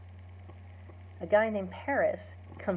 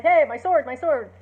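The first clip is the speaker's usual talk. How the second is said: mock shout for a sword; 6 semitones higher, 8 dB louder than usual. Old Capulet calls for his sword.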